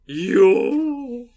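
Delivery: high, loud drawn yo; kabuki yo call. Shown as a demonstration. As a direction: belt a theatrical kabuki yo. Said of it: "doing a bad impression of the yo".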